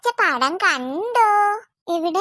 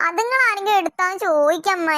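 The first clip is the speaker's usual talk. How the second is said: ordinary talk, nothing marked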